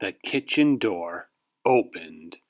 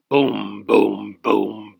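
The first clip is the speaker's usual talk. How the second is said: loud booming stomps; giant footsteps; 6 dB louder, much slower than usual. Acted. Giant father walking down the street, loud booming steps. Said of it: "David's father went like this".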